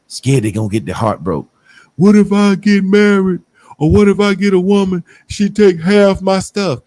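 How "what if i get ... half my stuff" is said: raised, louder mocking whine of a nervous man. Podcast host mocking men afraid to marry. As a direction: mimic a scared man fretting over marriage.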